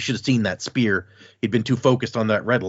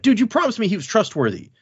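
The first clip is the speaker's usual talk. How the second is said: mildly pissed; 10 semitones higher, 3 dB louder than usual. Acted. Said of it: "Graves is pissed".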